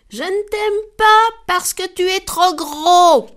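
high, loud, piercing cry; the little ant yells up at the elephant; shouting. Acted. The ant shouts at the elephant, high and loud. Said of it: "lui cria".

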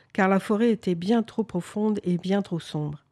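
ordinary delivery

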